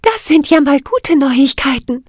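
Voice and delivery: light up with joy at the news; delighted fairy. Acted. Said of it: "strahlte die Mädchen begeistert an".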